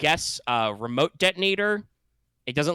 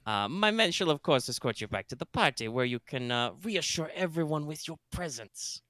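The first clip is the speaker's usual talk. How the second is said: put-on space German accent; 5 semitones higher than usual. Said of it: "What the fuck is my accent?".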